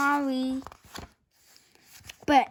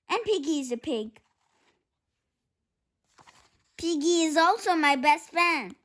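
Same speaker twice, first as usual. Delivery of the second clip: fast worried rush of words; much faster than usual. Acted. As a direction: blurt it out fast. Rushed explanation.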